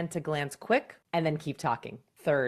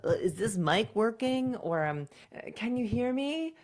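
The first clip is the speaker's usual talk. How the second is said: mock unsure presenter testing the mic; higher, halting, questioning mic check; hesitant mic check; 5 semitones higher than usual. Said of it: "don't throw it away with something technical".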